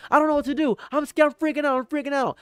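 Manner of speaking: very high, squealing mock terror; hammed-up freaking out. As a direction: squeal like a panicked victim. Enemy panics in imagined fight.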